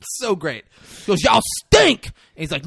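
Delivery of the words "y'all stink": much higher, louder jeer; insult. Retold or imitated. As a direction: spit it at the cops. Woman insulting the cops.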